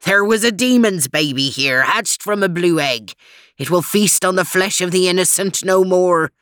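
Scottish brogue, grimly triumphant; vengeful Scot's cry. A narrator's reading. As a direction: declare it in a thick Scots burr. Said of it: "he cried".

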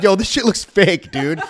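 slow, high, loud sneer; mocking scoff. Mocking the cops' badge, slow loud sneer. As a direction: drag it out with contempt. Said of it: "I'm like".

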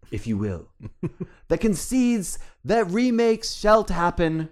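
put-on grand old-English herald voice; Shakespearean town crier. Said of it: "I'm dropping the Shakespearean tone".

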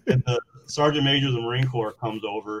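ordinary talk

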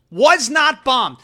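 slow "was not bombed"; emphatic correction. Real, not acted. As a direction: lean on each word.

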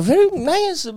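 high-pitched approving driver voice; mimicking the lady driver. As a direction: purr it out like the driver. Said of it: "she was like".

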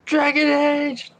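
high, slow eager shout; excited call-out. Real, not acted. Calling out a suggestion.

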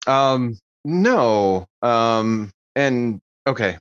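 ordinary delivery

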